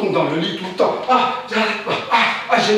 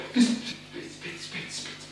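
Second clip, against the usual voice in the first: breathless whispered chant; 10 dB quieter, much slower than usual. Acted. Whispering to himself in a frantic panic. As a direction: hiss the words under your breath.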